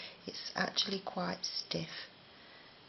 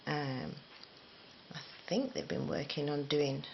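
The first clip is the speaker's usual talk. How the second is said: usual speaking voice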